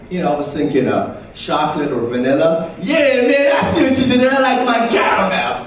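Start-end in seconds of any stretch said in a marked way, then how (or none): 2.8-5.5 higher, knowing bro voice. Retold or imitated. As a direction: wink at the innuendo.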